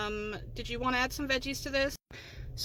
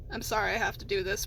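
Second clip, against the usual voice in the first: ordinary talk, nothing marked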